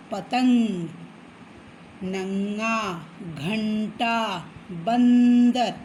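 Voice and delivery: each word drawn out slowly, the nasal ang sound stretched; drawn-out teaching of घंग, गंगा, पतंग, बंदर with the nasal sound held; nasal anusvara words. Shown as a demonstration. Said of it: "जैसे नाक में उच्चारण होगा".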